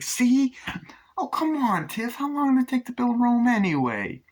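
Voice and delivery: high, exasperated cartoonish voice; pleading Chucky. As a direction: plead loudly up high, like a put-upon husband. Chucky pleading with Tiff, retold.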